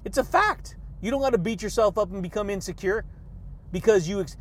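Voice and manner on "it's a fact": "It's a fact" jumps high and rises at the end; high rising repeat. Shown as a demonstration. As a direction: toss it back up high like a playful echo. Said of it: "accept them as a fact".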